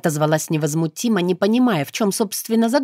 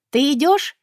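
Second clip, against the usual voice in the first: high call from another room; 9 semitones higher, 3 dB louder than usual. A narrator's reading. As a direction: call across the house. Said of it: "выкрикнула уже оттуда". (выкрик из кухни)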